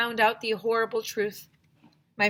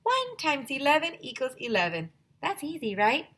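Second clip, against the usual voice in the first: puppet voice; 8 semitones higher than usual. Acted. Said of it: "said Mister Bongo".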